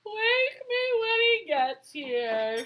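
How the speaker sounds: high, slow drowsy cat; sleepy murmur. Acted. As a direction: yawn it out half asleep. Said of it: "she murmured".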